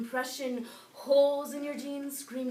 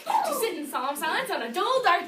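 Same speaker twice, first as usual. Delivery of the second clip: vocal warm-up tongue twister; 4 semitones higher, 6 dB louder than usual. Shown as a demonstration. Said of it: "warming up before audition".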